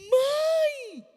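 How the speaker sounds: loud, high shout of protest; son's shout. Retold or imitated. Son shouting back at his mother from his room, loud and high. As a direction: yell it back at mom.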